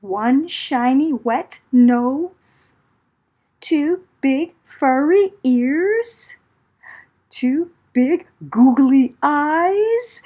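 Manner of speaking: storyteller's suspense build; creeping suspense. A narrator's reading. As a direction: build the scare line by line. Discovering the bear.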